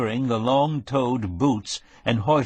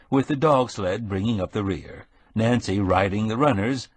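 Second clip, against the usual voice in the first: ordinary delivery, nothing marked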